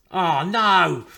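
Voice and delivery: the game's just slipped away; dismayed cry. Real, not acted. Streamer losing to a mate, loud dismay.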